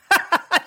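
short bright burst of laughter; real laugh. Real, not acted. Laughs at Patriots.

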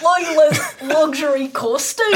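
laughing attempt at an old-timey voice; hammed-up olde English reading, cracking up; eighteenth century voice. Shown as a demonstration. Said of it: "eighteenth century voice".

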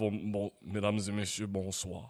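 extremely deep bass voice; solemn low newsreader voice; deepest voice. Retold or imitated. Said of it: "j'ai genre la voix la plus grave".

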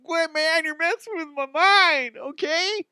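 very high-pitched freaked-out voice; panicked high guy. Pretend. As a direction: squeak it out, rattled. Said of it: "They're gonna be like".

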